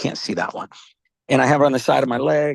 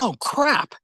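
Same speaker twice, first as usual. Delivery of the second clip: higher-pitched "uh-oh" realisation; mildly exasperated, pitched up; retold dismay; 9 semitones higher than usual. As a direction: replay suddenly catching your own slip. Retells sudden realisation of forgetting.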